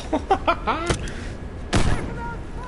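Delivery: sudden high-pitched burst of laughter. Real, not acted. Laughing at own political tangent, high squeal. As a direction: let a squeaky laugh break out.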